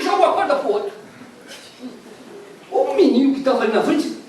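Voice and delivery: high, loud preaching at full force; fiery preaching. Acted. Preacher tells the congregation the shipwreck story, fiery and loud. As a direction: storm the story out from the pulpit.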